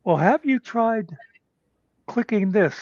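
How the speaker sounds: put-on child talking a grown-up through it; patient kid. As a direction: guide him gently like a little expert. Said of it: "the kid goes".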